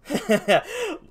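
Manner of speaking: high-pitched mischievous cackle; gleeful cackle. Pretend. Storyteller on the foiled wallet thief, gleeful cackle. Laughing at the failed pickpocket.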